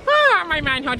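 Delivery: high, loud pained yelp; comic shriek at the icy sea; cold-water yelp. Acted. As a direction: gasp it out as freezing water hits.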